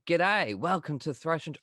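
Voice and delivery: overdone Australian accent. Shown as a demonstration. Aussie band selling themselves to Americans, overdone Australian accent. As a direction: lay the Australian accent on thick. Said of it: "we tend to overdo it".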